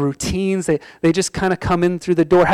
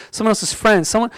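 usual speaking voice

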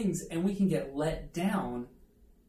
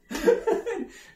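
high, loud burst of laughter; high giggling laugh; real laugh; 6 dB louder than usual. Real, not acted. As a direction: laugh freely at yourself. Laughs after the punchline.

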